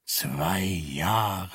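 low grumble from the professor; grumbling. A narrator's reading. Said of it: "brummte der Professor". (tief vor sich hin gebrummt)

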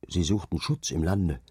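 plain reading voice

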